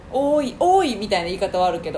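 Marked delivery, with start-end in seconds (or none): 0.1-1.0 calling out. Shown as a demonstration.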